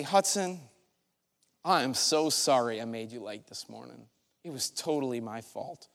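low, soft, sincere apology; gentle, hushed admission of fault. Retold or imitated. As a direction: drop down low and quiet to apologize to your child. Retells apologizing to his son.

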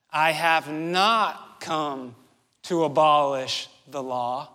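"not" slow and weighted, whole line slow; stress on NOT. Shown as a demonstration. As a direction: lean hard on "not", draw the line out. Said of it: "he repeats it".

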